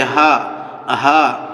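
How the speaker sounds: হা said low and heavy; low, full-voiced হা; deep, grave ha. Shown as a demonstration. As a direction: drop the pitch for a solemn, weighty হা. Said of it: "গম্ভীরভাবে আওয়াজ করতে হবে".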